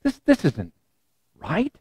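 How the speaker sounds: soft, halting inner thought; thinking to himself. Retold or imitated. Peter thinking in his dream, hushed and unsure. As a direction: voice a quiet, hesitant private doubt. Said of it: "what he thinks is".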